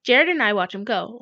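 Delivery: ordinary delivery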